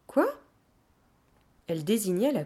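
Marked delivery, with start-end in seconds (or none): 0.1-0.4 high, rising squeak of a question; baffled. Acted. Child baffled by talk of washing, surprised. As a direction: ask in high-pitched bewilderment.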